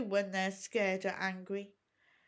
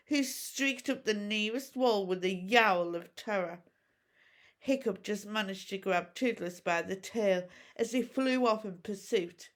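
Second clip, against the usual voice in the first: ordinary delivery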